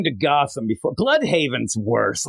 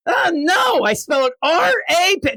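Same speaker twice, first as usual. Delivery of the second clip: put-on silly kid. Kid answering a spelling question, high childish shout.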